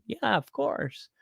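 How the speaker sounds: high, slow, soft mock reply; a lightly voiced girlfriend, drawn out and high; imitating her reply. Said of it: "she's just like".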